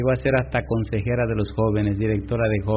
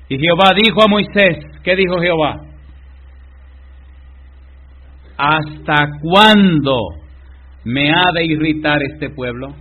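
loud, high preacher voice, dragging each word; slow, loud "¿Hasta cuándo" drawn out in righteous anger; forceful scripture reading; 4 semitones higher, 6 dB louder, slower than usual. Acted. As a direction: thunder God's complaint slowly.

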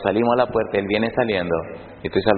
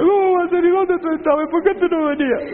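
imitated joyful cry. Pastor imitating Leo crying out in joy at their reunion, much higher. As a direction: squeal it like a grown man becoming a child again. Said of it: "va a pegar un grito".

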